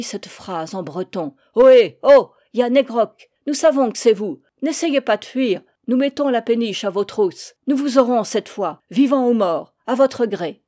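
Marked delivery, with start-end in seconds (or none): 1.5-10.6 crié fort et haut dans la nuit; héler le fuyard au loin; appel au porte-voix. Acted. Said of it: "muni d'un porte-voix, lança dans la nuit". (un douanier hèle Yann He Grok au porte-voix)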